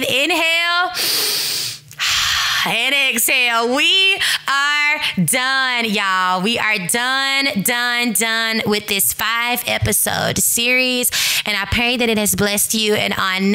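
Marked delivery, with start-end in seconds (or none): 0.0-3.7 breathing guide. Shown as a demonstration. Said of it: "you can breathe". 3.7-11.1 slow relief. Real, not acted. Slow celebratory finish.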